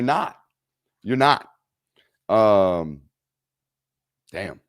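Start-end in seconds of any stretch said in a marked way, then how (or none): none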